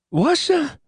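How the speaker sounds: high, slurred startled yelp; incoherent blurt. A narrator's reading. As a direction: blurt it half-formed in shock. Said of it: "I blurted incoherently".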